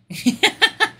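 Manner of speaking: high hyena giggle in repeated bursts; incessant giggling. Acted. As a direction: keep giggling helplessly at a high pitch. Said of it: "incessant giggling".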